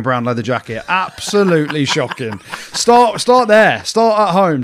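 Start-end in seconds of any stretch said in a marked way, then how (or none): 2.7-4.6 mock insistence. Real, not acted. Mocking friend demanding the story.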